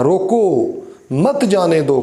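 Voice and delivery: "रोको" set apart by a break before "मत जाने दो"; pause after रोको. Shown as a demonstration. Teacher repeating the first example for students, pause after रोको. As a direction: halt after रोको, then say मत जाने दो in one go. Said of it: "क्योंकि वक्फ़ा किया".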